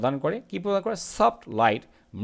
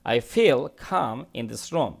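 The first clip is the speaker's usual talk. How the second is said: each word read out slowly and clearly; slow model reading; much slower than usual. Shown as a demonstration. Teacher reading the sentence slowly to students. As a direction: read it slowly for the class to follow. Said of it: "teacher reads model sentence slowly".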